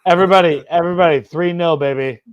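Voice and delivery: loud, high crowing to everyone; gleeful announcement. Real, not acted. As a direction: call it out to the whole chat. Announcing score to chat.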